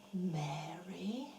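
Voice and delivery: breathy whispered name; just breathe her name softly. Acted. Jesus gently calling Mary by name, whispered. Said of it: "Then the man said".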